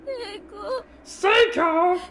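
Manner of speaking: high desperate cry of a name; calling out. Acted. Naomi crying out for Seiko, high and desperate. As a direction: call out for your lost friend, high.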